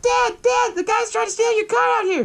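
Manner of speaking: high mock child yelling for dad; calling kid. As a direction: call out to your dad like a tattling kid. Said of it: "the kid's like".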